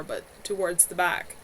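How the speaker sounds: ordinary delivery, nothing marked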